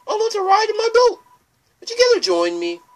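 very high, loud lilting offer; high cheery boat invitation; singsong ferryman. Acted. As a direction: sing it out like a whimsical boatman. Player voices River Person.

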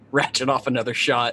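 ordinary delivery

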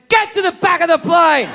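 a high shouted order, pointing him off; commanding shout. Retold or imitated. Comedian to seatmate, shouting him to the back. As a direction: bark it out like a triumphant order. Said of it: "I point at the man and I went".

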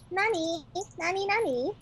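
cute anime streamer. Acted. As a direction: squeak like an anime girl. Anime girl act continues.

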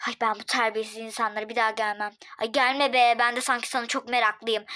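normal talking voice

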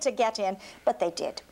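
plain reading voice

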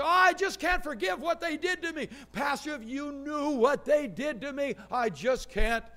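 lightly voiced complainer, plaintive; mimicking a complainer. Pastor mimicking hurt congregants to the congregation, lightly plaintive. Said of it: "There's a lot of people say".

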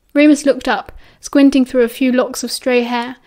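usual speaking voice

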